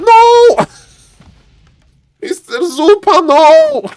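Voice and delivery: very high, loud desperate cries of no; panicked shout. Real, not acted. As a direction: scream in horror at the mistake. Caster panics at worker loss.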